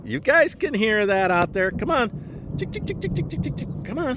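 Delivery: high ticking call to chicks; calling chickens. Real, not acted. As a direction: coax the chicks with high ticks.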